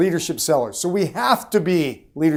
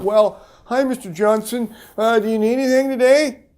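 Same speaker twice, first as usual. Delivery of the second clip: higher, hesitant, asking-for-scraps voice; timid seller; 6 semitones higher than usual. Shown as a demonstration. Weak salesman greeting Mister Johnson, meek and higher. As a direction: sound unsure and meek, pitch up. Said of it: "salespeople who act like that get treated poorly".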